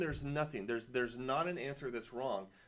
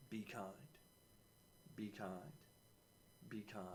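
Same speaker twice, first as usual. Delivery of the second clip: softer and softer each time; fading refrain; 3 semitones lower, 14 dB quieter than usual. Acted. Teacher repeating the lesson's refrain to students, fading softly away. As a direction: let the words fade like an echo.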